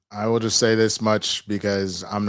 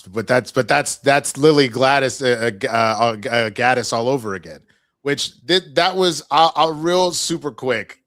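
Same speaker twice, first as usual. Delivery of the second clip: excited stumble; 3 semitones higher, 7 dB louder than usual. Real, not acted.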